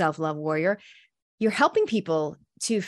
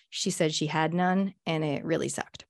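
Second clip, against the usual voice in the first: ordinary delivery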